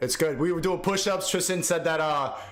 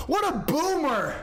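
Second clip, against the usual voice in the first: mocking scorn; 7 semitones higher, much slower than usual. Real, not acted. Mocking guest using Zoom.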